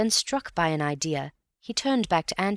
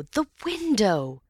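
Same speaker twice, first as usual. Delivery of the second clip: crying out; 5 semitones higher, slower than usual. Acted. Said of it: "cried Antony".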